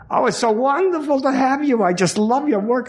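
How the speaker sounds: high, sugary gushing woman's voice; gushing directress. Retold or imitated. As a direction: play the star-struck directress fawning over him.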